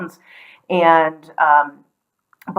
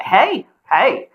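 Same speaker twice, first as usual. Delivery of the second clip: higher-pitched Carolina "hey, y'all"; Southern greeting; 4 semitones higher, 3 dB louder, slower than usual. Shown as a demonstration. Showing how North and South Carolina greet, Southern accent. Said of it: "We say".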